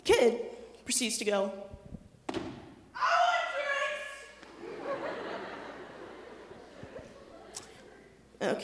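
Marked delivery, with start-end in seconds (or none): 2.3-8.6 put-on toddler voice. Toddler demanding at table.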